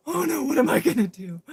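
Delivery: loud, high panicky cry; a new cop losing his nerve; alarmed wail; panicked. Retold or imitated. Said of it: "he was like".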